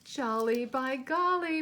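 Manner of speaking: loud, high, gleeful outburst; jolly shout. A narrator's reading. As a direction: burst out with delight like a happy bear. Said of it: "Barnaby said with a kick".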